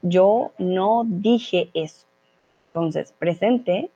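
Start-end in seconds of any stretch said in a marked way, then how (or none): 0.0-2.1 slow, spelled-out teacher example; very slow "Yo no dije eso"; slow model sentence. Shown as a demonstration. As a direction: say it word by word for learners. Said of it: "veo que la mayoría respondió muy bien".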